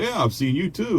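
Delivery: high-pitched feigned casual reply; playing along. Man answers her pretend greeting, high and playing along. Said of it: "here I am pretend".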